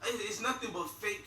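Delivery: ordinary talk, nothing marked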